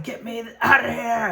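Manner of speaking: desperate yell. Acted. Trapped in the pod, pounding and yelling to get out. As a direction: beg to be let out, pounding.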